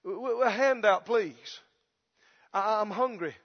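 soft, halting beggar's plea; acted hungry street beggar; pleading beggar. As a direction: beg timidly for a handout. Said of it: "he's having to plead with people".

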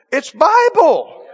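It's Bible, high and drawn out with force; emphatic insistence. Real, not acted. Preacher insisting to the congregation, slow and high. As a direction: lean hard on it, slow and high.

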